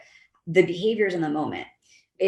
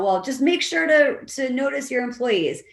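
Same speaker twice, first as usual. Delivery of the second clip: mock condescending advice, higher and slow; dismissive advice; 6 semitones higher than usual. Boss giving lazy advice to a manager, mock condescending. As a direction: lecture like a boss who can't be bothered.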